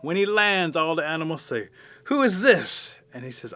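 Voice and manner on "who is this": high, loud exclaimed question; astonished question. Acted. Animals see blackened raven land, loud surprised question. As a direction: call out in acted surprise.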